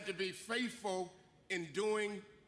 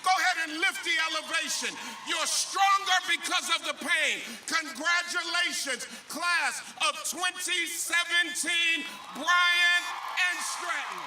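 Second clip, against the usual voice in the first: much louder, shouted preaching climax; preaching at full force; 6 semitones higher, 9 dB louder than usual. Acted. Bishop sending off the class, full-force shouted preaching. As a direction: drive the graduates home with a rising shout. Commencement address building to climax.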